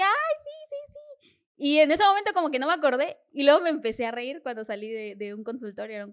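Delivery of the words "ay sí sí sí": overjoyed. Retold or imitated. Seller reliving a first big book sale, overjoyed. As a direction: gleefully re-enact being thrilled, voice way up. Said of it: "yo estaba bien feliz".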